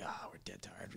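sleepy, breathy complaint; pretending to be dead tired; mock tired whisper. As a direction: whisper it, drowsy and worn out. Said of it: "we were like".

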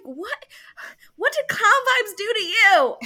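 high, loud exclamation of disbelief; outraged mock yell. Retelling her reaction to the hacker, mock-outraged yell. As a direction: jump up in pitch and yell it, hammed-up outrage.

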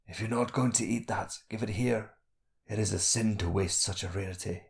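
soft, weak skinny prisoner; faint voice. A narrator's reading. Skinny prisoner stopping the boy wasting food, faint voice. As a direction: ask softly, with little strength left. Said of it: "His voice was low and faint".

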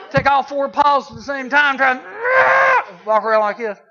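high, loud, dragged-out shout; loud slowed acting-out. Preacher acting out his cat fighting the leash, loud high slow shout.

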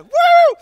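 very high, loud roller-coaster whoop; long high excited woo; teen woo. Retold or imitated. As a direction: whoop like kids on a ride. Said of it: "it sounded like this".

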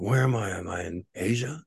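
mildly hammed-up disorientation; retold bewilderment. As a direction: act out waking up confused. Retells waking lost and confused.

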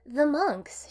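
cheerful, airy answer; bright reply. A narrator's reading. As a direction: say it lightly and brightly. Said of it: "she replied brightly".

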